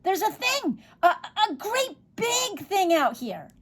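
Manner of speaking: high, urgent yells for help; alarmed shouting. A narrator's reading. Boy calling his parents to see the thing, high alarmed shout. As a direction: shout very high, stretching out the last phrase. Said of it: "Overy was shouting".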